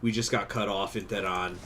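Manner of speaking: ordinary talk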